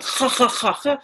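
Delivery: harsh guttural KH for each "ha"; mock Spanish throaty H; imitated Spanish throat sound. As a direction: rasp "ha, ha, ha, ha" in the throat. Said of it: "when the Spanish guys speak English".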